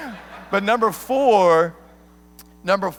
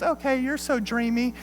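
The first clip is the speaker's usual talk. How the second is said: put-on high, soft, swooning girl voice; Nala; 6 semitones higher, 5 dB quieter than usual. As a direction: coo like a smitten girlfriend, hammed-up and high. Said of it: "And she goes".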